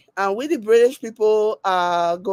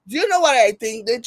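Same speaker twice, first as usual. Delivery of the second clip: ordinary talk, nothing marked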